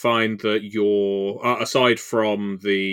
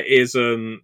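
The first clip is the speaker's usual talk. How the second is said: ordinary delivery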